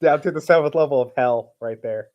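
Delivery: ordinary delivery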